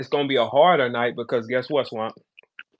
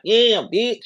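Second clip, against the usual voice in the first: "Yeah, bitch" yelped high and a bit louder; mocking girl rapper; 8 semitones higher, 4 dB louder than usual. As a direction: imitate him sounding like the girls. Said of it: "and you gonna be like".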